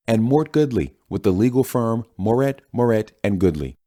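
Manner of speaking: bright, high TV anchor delivery; news anchor read. Acted. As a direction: present it like a cable news host. Anchor opens special report.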